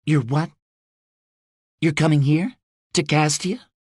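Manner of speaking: can't believe what he just said; disbelieving. A narrator's reading. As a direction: Rose hearing her father is coming. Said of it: "A disbelieving pause".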